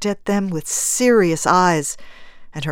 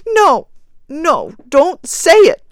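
high, loud, frantic protest; wild cry; 11 semitones higher, 9 dB louder than usual. A narrator's reading. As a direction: cry out wildly to stop the husband. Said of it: "cried the woman wildly".

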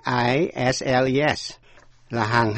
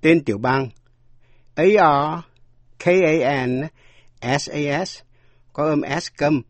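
normal talking voice

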